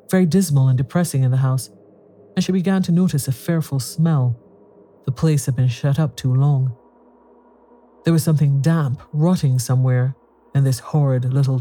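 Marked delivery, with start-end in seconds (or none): none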